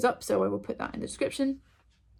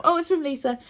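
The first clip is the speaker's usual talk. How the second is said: pleased surprise; 8 semitones higher, 5 dB louder than usual. Real, not acted. Discovers who sent the parcel.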